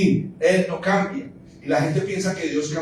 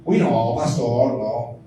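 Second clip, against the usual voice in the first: reluctant churchgoer; 6 semitones lower, slower than usual. Acted. Mimics reluctant member rejecting correction.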